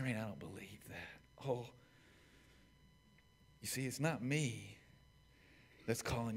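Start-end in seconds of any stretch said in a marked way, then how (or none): 0.0-1.4 unvoiced whisper of disbelief; soft secret whisper; whispered doubt. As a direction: whisper it like a sceptic. Voiced doubter objection.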